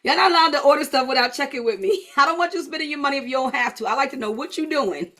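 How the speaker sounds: teasing telling-off that breaks into laughter; laughing playful scolding. Real, not acted. Coach teasing clients about spending, laughing. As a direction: scold them fondly, laughing as you go.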